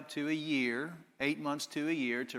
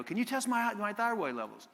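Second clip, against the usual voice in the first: mock anxious patient; 8 semitones higher than usual. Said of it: "and they go".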